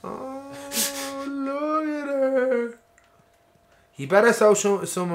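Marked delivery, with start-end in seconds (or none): 0.0-2.8 high, drawn-out mock wail; mock whimper. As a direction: stretch it out in a high, pitying whine. Mock distress over game girl.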